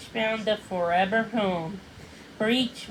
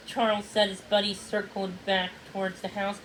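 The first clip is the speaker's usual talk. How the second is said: ordinary delivery, nothing marked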